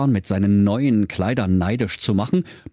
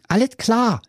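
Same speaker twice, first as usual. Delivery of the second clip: Höke's voice; 12 semitones higher than usual. Acted. Höke antwortet.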